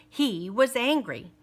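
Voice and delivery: ordinary delivery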